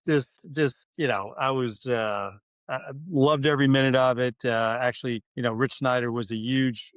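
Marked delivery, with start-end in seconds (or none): none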